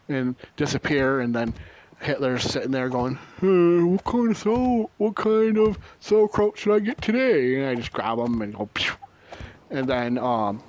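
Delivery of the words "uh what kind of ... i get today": high, put-on voice, slowed at the start; dopey clone voice. Hitler's clone dithering over sauerkraut, in a high dopey put-on voice. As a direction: play him clueless while he shops.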